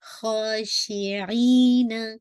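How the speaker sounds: ख़ाशिईना with a heavy ख़ा from the throat and a long ई; full-mouth kha. Shown as a demonstration. Teacher demonstrates heavy kha for the class, slow chant. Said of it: "ख़ा full of mouth के साथ खा".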